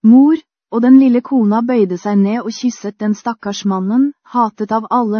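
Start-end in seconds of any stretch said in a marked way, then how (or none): 0.0-0.4 rising cry of Mor, louder on the second; a kneeling prisoner calling out to his mother; desperate call, louder second time. Acted. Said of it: "faller på kne og roper".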